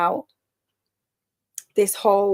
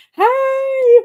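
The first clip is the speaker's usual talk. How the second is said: high, loud squeal of Hey; excited greeting; 9 dB louder than usual. Real, not acted. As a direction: greet a friend with delight. Greeting a chat friend.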